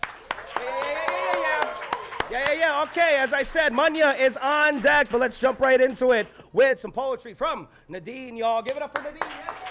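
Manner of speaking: high-pitched open-mic host intro; rousing high-pitched stage introduction; hype MC announcement. Acted. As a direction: hype up the crowd for the next poet.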